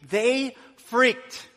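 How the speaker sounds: ordinary talk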